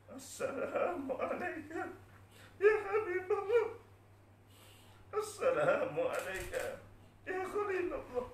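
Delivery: slow, very high sobbing wail; voice spent from crying. Acted. Bilal at the Prophet's grave, sobbing salutations. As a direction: stretch each greeting into an acted sob. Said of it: "Suaranya sudah kehabisan ketika menangis".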